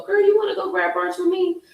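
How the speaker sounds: put-on bright high invite to a girlfriend; high girlfriend call. Inviting her girlfriend to brunch, high and chirpy. Voicing herself calling a friend.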